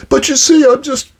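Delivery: high, whining mock excuse; whiny employee. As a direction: squeak out a pitiful excuse.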